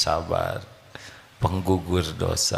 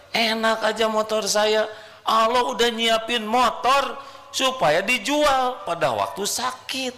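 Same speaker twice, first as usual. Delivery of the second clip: mock whiny complaint; 12 semitones higher than usual. Preacher mocking a complainer, high sing-song. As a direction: parody a grumbling believer.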